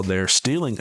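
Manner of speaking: usual speaking voice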